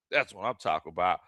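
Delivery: ordinary talk, nothing marked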